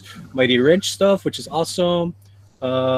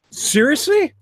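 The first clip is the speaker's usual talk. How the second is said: high, surprised question; disbelieving; 13 semitones higher, slower than usual. Real, not acted. Host hears he never had the exam, incredulous. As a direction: pitch leaps way up in disbelief.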